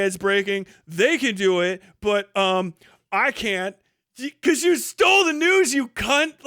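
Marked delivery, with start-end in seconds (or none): 4.2-6.4 loud, high, furious jab at him; angry outburst. Real, not acted. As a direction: spit the insult out in real anger. Ranting at a news thief.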